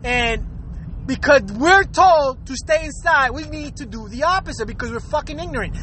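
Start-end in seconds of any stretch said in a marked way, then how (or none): none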